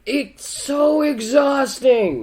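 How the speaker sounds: slow, high, drawn-out sigh of complaint; drawn-out weary complaint; exasperated. Real, not acted. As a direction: stretch it, worn out. Emphatic agreement, drawn out.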